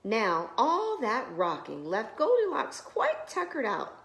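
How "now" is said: ordinary delivery, nothing marked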